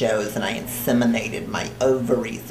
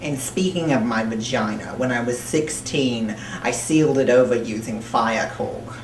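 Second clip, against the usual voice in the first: thick put-on New Jersey accent, held all the way; 3 dB louder than usual. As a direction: talk like a crude New Jersey broad throughout. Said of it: "I don't know where the accent came from".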